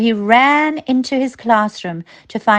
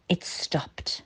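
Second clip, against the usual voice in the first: hushed, breathy whisper; 2 semitones lower, 12 dB quieter than usual. A narrator's reading. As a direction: drop to a sudden whisper. Sudden stop in story.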